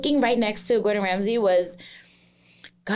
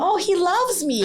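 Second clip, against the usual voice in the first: higher, giddy mock delight; 9 semitones higher than usual. Shrugging off Ramsay's yelling, giddy mock delight. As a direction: coo it like a compliment. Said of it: "So I'm like".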